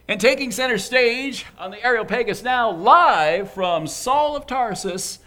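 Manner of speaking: announcer intro. Pretend. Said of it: "I'm never gonna work for Saturday Night Live".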